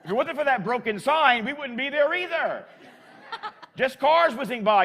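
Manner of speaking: laughing. Real, not acted. Laughing at the absurd scene.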